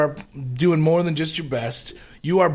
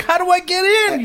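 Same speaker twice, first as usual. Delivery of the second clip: high voice; 12 semitones higher than usual. Retold or imitated. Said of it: "You're like".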